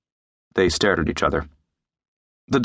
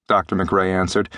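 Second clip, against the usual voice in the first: plain reading voice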